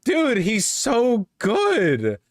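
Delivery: high, slow, drawn-out admiration; amazed praise. Real, not acted. Streamer marvelling at the candidate's composure, high and slow. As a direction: gush with awe.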